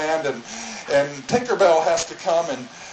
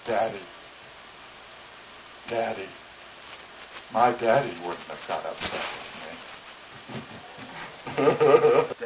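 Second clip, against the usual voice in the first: lower, soft whimpering plea; mock tearful child pleading; whimpering daughter; 8 semitones lower, 8 dB quieter than usual. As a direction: plead through little sobs. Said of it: "She says".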